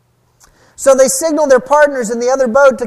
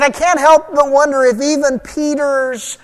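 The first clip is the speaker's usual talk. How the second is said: usual speaking voice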